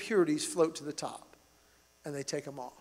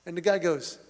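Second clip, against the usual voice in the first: ordinary delivery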